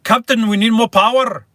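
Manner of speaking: loud, high Scottish engineer cry; Scottish accent. Shown as a demonstration. Livestreamer attempting a Scottish accent for viewers, loud Scottish accent. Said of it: "The best I can do is".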